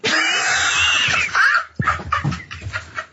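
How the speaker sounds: high, breathy burst of laughter; big laugh. Real, not acted. Producer laughs at Carl's joke, big breathy laugh. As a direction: crack up helplessly.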